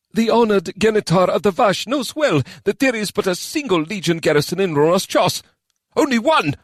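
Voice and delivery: heated retort, peaking on only one; vehement. Acted. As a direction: spit the words out hotly. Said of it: "responded with such vehemence".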